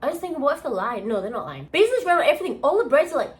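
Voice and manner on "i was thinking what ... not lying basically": ordinary talk, nothing marked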